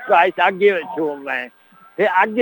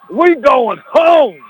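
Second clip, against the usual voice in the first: slow bellowed order; coach shout; 6 semitones higher, 6 dB louder, much slower than usual. Acted. Imagined coach bellows at his beaten team, slow loud shout. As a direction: yell it like a furious coach pulling his team.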